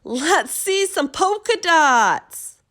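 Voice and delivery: high, loud, slow shout; yelling kid. A narrator's reading. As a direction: yell it across the classroom. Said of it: "One yelled out".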